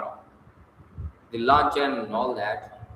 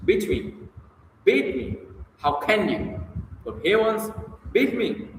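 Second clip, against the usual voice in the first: distressed pleading; 7 semitones higher than usual. Acted.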